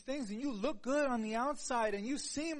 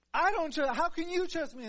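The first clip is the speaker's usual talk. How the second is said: louder, high, laughing at himself; excited disbelief; 5 semitones higher, 6 dB louder than usual. Real, not acted. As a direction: tease yourself in loud disbelief. Laughing self-mockery, unbelieving.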